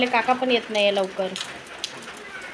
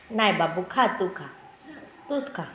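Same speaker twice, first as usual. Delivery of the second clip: laughing softly; 6 semitones lower, 7 dB quieter than usual. Real, not acted. Laughs while refusing child.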